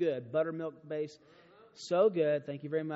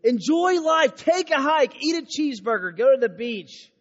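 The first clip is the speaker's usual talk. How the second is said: higher and louder, rousing the crowd; full-force preaching; 8 semitones higher, 9 dB louder than usual. Acted. Urging the students to enjoy life, loud preaching. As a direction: shout it out like a preacher.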